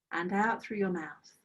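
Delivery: calm, unhurried meditation guide voice; guided meditation. Acted. Teacher guiding students through a breathing practice, calm meditation voice. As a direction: lead the students gently into body awareness.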